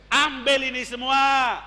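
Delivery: slow, loud solemn command; prophet's verdict. Acted. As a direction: hand it over with finality. Jesus dismisses the disciple.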